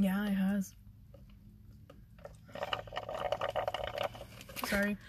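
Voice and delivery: words around sips of juice; while sipping a drink. Real, not acted. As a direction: talk between sips of juice. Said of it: "I'm drinking my juice".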